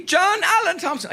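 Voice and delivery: high, over-innocent lie to the cop; lightly put-on innocent voice; fake innocent. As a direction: sell the fake name sweetly. Adding fake middle name.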